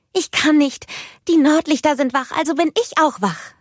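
put-on high, loud, eager child voice; bubbling over with excitement; excited little girl. A narrator's reading. (hohe, aufgeregte Kinderstimme)

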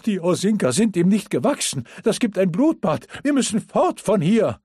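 high, loud, panting alarm; breathless panic. Acted. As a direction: gasp it out in panic. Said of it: "keuchte er atemlos".

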